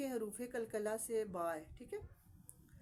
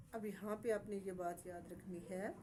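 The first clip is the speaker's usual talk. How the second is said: ordinary delivery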